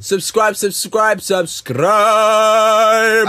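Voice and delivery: loud, high chant building to a long held last word; hyped-up subscribe call. Pretend. Host urging viewers to subscribe, hyped and loud. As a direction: belt it out, stretching the final one.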